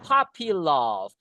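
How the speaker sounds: Puppy said as "pappy", the U read like A; puppy with A sound. Shown as a demonstration. Teacher correcting how Puppy is read, U as A. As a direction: say Puppy with an A vowel, "pappy love". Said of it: "Ini U-nya dibaca A".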